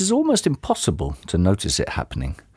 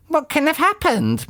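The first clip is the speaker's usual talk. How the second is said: put-on much higher, dim old woman's voice; squeaky puzzled old lady; Missus Twit voice. A narrator's reading. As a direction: play her as a high, befuddled old wife.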